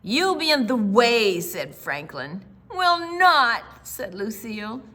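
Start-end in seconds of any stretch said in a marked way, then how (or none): 0.0-1.4 lightly voiced bossy older brother, louder; big brother. A narrator's reading. Franklin telling little sister she'd be in the way. Brother teasing in read story. 2.7-3.6 put-on loud high childish protest. A narrator's reading. Lucille insisting to her brother she will come.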